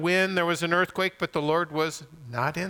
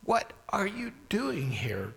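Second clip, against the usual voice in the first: higher, slow half-whispered question; God's hushed question; 6 semitones higher, 4 dB quieter than usual. Acted. God questioning Elijah, hushed and slow. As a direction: ask it in a drawn-out near-whisper. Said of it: "God said".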